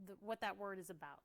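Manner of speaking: normal talking voice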